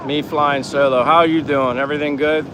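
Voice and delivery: ordinary delivery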